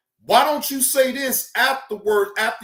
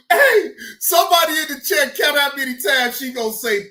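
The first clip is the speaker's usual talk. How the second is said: normal talking voice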